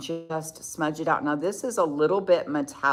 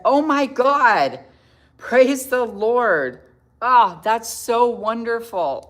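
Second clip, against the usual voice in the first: high, loud and slow with joy; thrilled outburst, drawn out; overjoyed; 6 semitones higher, 7 dB louder, much slower than usual. Real, not acted. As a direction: gush with delight at the news. Overjoyed at viewer's sobriety news.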